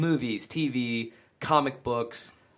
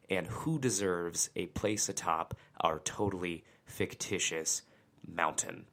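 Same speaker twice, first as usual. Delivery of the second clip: whispering; 4 semitones lower, 8 dB quieter, slower than usual. Acted. Mock-dramatic aside in intro.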